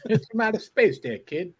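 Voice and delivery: put-on thick Boston accent, dropped r; Boston guy ribbing a kid. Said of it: "You do a Boston accent better than me".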